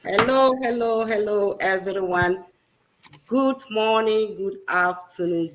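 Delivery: ordinary delivery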